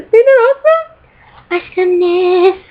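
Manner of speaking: high, squealy gush; gushing fangirl. Real, not acted. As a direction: draw it out in a high, delighted coo. Gushing over an autograph to camera.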